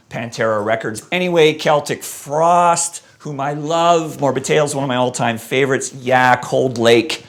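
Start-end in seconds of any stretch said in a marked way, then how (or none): none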